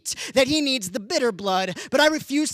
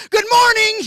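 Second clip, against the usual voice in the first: loud, high morning yell; yelled greeting; 11 semitones higher, 8 dB louder than usual. Acted. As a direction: holler it right in someone's face. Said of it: "he yells in my face".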